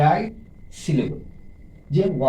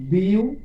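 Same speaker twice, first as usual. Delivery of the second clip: "Beautiful" as বিউ, টি, ফুল with long gaps; syllable by syllable; 4 semitones higher than usual. Shown as a demonstration. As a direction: separate each syllable of beautiful. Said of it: "তিনটি".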